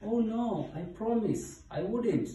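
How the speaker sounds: high-pitched, earnest hippo voice; pleading high character voice; protesting hippo. A narrator's reading. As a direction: promise it in a high squeal of protest. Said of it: "said Hippo".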